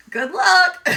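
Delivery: high, loud, ironic good luck; wry good luck. Retold or imitated. Owner handing the emu leash to the stranger, wry and loud. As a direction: wish him luck with a wink in the voice.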